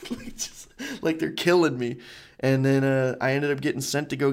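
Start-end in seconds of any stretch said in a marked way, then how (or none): none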